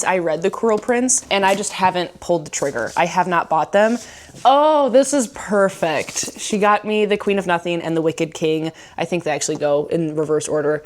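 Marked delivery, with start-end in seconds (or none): 4.5-6.4 delighted. Real, not acted. Opens the gift books.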